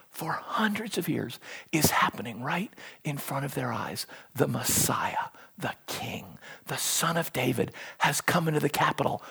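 very soft, low, weighty delivery; hushed preaching. Acted. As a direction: let each phrase land in a near-whisper. Preacher voices Jesus, then crowd's expectation, hushed.

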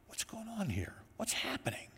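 breathless whisper of suspense; whispered dramatic question; hushed whisper. Acted. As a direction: drop to a whisper as if afraid to ask.

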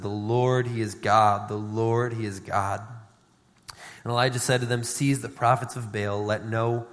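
solemn scripture reading voice. Acted. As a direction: read the account to the congregation. Long scripture reading, lower voice.

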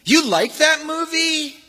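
high, slow, scornful mockery; high drawn-out mocking disbelief; sneering. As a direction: sneer at a kid's taste. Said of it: "somebody said".

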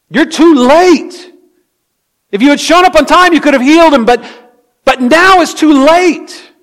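shouted reproach at someone who came too late; anguished accusing shout. Acted. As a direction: cry it out in loud, high reproach. Acts out the grieving objection to Jesus.

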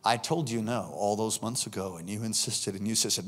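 a lightly voiced, low, soft Lord; voice of God. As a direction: quietly rebuke like a grieved father. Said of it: "the Lord said to me".

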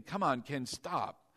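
low, soft self-scolding; quiet aside, as a thought; inner voice. Retold or imitated. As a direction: murmur it privately to yourself. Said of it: "in my mind I'm thinking".

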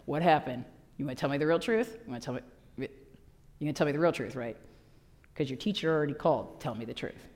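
low, soft, quick parental grilling; a hint of a probing parent. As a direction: coax the truth out of him. Parent interrogating the son.

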